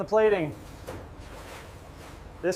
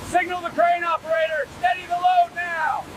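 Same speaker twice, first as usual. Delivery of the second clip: shouting orders; 8 semitones higher than usual. Real, not acted. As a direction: call it out across the deck. Calling out over heavy machinery.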